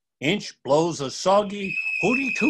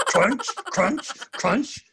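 high crunching sounds; 9 semitones higher, much slower than usual. A narrator's reading. Squirrel crunching noise.

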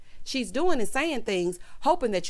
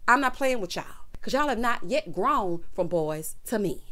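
ordinary talk

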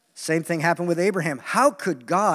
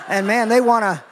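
usual speaking voice

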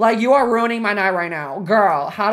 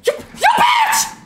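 high, slow, drawn-out yell; real frustrated screech; frustrated shout; 3 dB louder, slower than usual. Real, not acted. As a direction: scream it out at the game.

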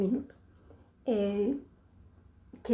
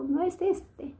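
quoting the clerk; 7 semitones higher, slower than usual. Retold or imitated. Store attendant continues.

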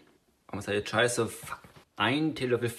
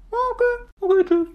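squeaky voice; 5 dB louder than usual. Acted. High squeaky goodbye skit.

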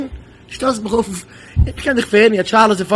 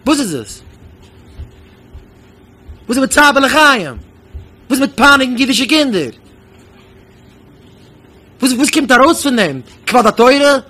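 raised, forceful rhetorical questions; indignant challenge; 3 semitones higher, 4 dB louder than usual. Real, not acted. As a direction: demand an answer from the audience.

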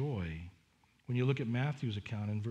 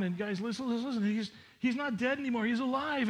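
climbing higher to a joyful peak; excited plea; 8 semitones higher than usual. Acted. Women urging the apostles to listen, rising excitement. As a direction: build from urging to triumphant.

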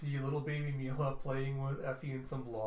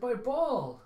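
put-on high, loud, excited toddler squeal; gleeful high-pitched kid voice; little kid; 10 semitones higher, 6 dB louder than usual. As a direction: play a small child at play. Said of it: "kid Mila".

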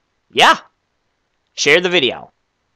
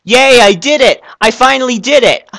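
overjoyed cheering; 8 semitones higher, 3 dB louder, slower than usual. Acted. Celebrating his success.

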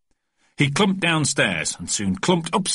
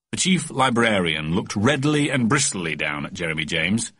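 ordinary delivery, nothing marked

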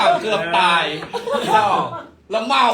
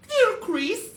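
put-on accented English word; 12 semitones higher, much slower than usual. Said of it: "อะไรอีตูน".

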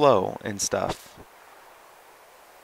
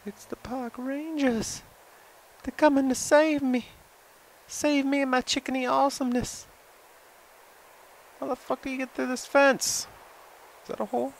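high excited voice; 12 semitones higher than usual. Real, not acted. Excited, hopeful about rangers.

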